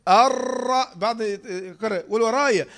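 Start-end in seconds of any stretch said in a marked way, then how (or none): 0.1-0.9 louder "الر-" with the ر rolled too many times; over-trilled raa. Shown as a demonstration. Teacher warning against too much repetition, over-trilled "الر-". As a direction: exaggerate the roll on "الر-". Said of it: "لا، لا تقول إيه؟".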